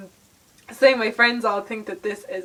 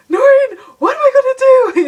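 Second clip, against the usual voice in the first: very high, loud, whiny mock wail; mock panic; 12 dB louder than usual. Pleading with her best friend Noreen for help, a hammed-up high wail. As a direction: wail it like a hammed-up damsel in distress.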